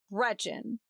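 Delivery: cold quiet call. A narrator's reading. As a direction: say her name icily. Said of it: "he said coldly".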